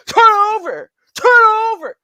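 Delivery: shouted order, high and loud; yelling command. Retold or imitated. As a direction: bark the order like a cop. Retold cop yelling command.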